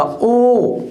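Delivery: ओ drawn out long; long sound. Shown as a demonstration. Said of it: "sound लंबा जाएगा".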